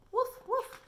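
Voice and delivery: high, loud little woof woof; puppy woofs. Retold or imitated. Teacher recalling the dogs barking, high yappy woofs. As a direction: yap it out like a small dog. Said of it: "they said".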